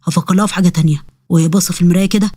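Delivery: low-pitched, grumbling ifrit voice; scheming ifrit. Acted. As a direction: drop the pitch and plot to scare the girl.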